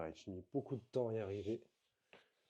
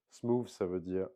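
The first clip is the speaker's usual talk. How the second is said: usual speaking voice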